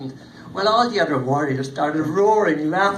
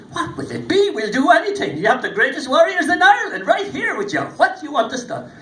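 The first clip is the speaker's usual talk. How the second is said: eager warriors; 9 semitones higher, 6 dB louder than usual. Acted. Fionn eager to please.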